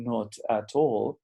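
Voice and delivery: slow, every t clear: "not at all"; slow neutral form. Shown as a demonstration. Teacher shows a slow neutral version to students. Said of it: "Si estuviese neutral".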